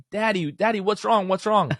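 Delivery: put-on high, worried little-kid voice. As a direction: squeak it like a worried child in the back seat. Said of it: "my kids are in the back like".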